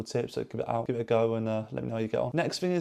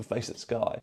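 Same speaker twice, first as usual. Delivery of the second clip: normal talking voice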